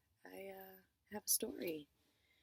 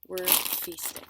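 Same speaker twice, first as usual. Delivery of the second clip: excited; 10 dB louder, slower than usual. Real, not acted. Loud gleeful.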